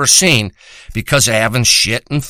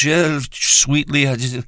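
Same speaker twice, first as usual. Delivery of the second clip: mock tender lover. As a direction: sneer it as a soppy gentle lover. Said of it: "not like you treat some bitch".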